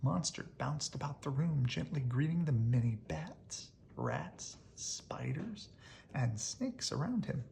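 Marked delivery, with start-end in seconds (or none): none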